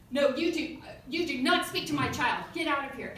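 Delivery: loud, emphatic retold order; insistent mock scolding, loud; imitated screaming mom. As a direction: play an outraged mother shouting someone down. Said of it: "She's screaming at me".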